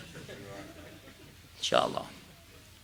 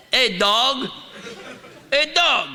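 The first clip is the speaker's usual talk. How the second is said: higher, louder mock teen greeting, rising each time; teen calling out; 10 semitones higher, 3 dB louder, slower than usual. Teenager greeting his friend, imitated higher and louder. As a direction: call your buddy out in slang. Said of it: "He said".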